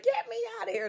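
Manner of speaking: high squeaky plea; mock plea. Someone in a padded room begging, in a high squeal.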